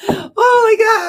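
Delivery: a squealed exclamation of shock; surprised outcry. Real, not acted. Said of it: "Holy fuck, I can't see that".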